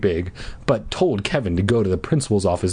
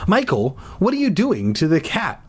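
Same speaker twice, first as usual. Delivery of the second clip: high-pitched scolding mom voice; demanding mom; 9 semitones higher than usual. A narrator's reading. Mom catches son with the cat, demanding. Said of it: "Mom demanded".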